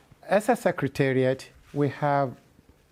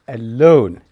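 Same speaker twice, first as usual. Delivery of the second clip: alone punched much louder; stress on alone; 13 dB louder than usual. Real, not acted. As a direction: hit the word alone hard, with a falling tune. Stressing a parish's wealth.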